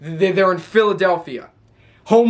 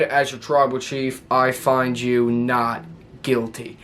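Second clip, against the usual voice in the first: low, slow solemn verdict; Roman Reigns; 6 semitones lower, 3 dB quieter, slower than usual. Retold or imitated. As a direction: pronounce judgement like a chief, pitch dropped. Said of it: "And then Roman Reigns said".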